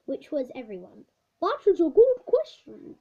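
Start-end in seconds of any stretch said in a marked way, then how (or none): 1.4-3.0 higher, louder teacher voice; the old teacher, pleased by a question. Acted. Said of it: "replied the teacher".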